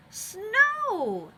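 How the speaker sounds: high, loud joyful cry of snow; excited shout. A narrator's reading. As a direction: shout it out in delight at first sight. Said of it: "they shouted".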